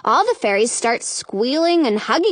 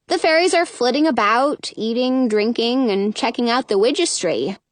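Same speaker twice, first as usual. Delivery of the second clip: ordinary delivery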